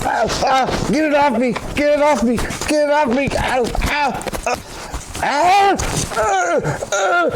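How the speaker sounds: high panicked screaming; shaking off a squirrel. Acted. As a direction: shriek in panic, squirrel on you.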